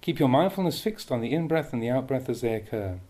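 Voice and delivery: ordinary talk, nothing marked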